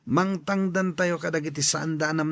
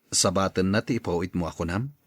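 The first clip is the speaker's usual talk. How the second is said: low, deeper put-on man's voice; older man voice; 11 semitones lower, 4 dB quieter than usual. Tata Ben answering the boy in a low voice. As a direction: drop low like an older uncle.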